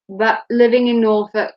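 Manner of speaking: ordinary delivery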